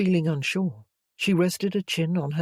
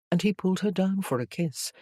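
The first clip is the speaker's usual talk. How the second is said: ordinary delivery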